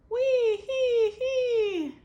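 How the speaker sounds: very high, loud, slow laughing wee hee hee; mocking laugh. Beatrice laughing back across the wall, high and loud. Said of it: "she laughed back".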